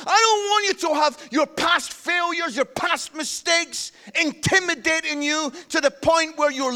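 high, loud rousing preacher; full-force preaching. Acted. Preacher declaring God's word to the congregation, full-force preaching. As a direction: proclaim it with mounting force, easing at the close.

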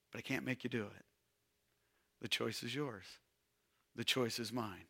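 soft, low, intimate; hushed. Real, not acted. Preacher softly telling the congregation the choice is theirs. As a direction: lean in and say it quietly.

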